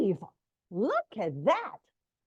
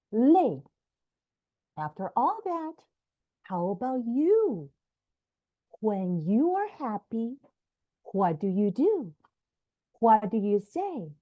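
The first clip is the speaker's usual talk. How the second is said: ordinary delivery, nothing marked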